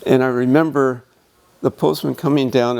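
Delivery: usual speaking voice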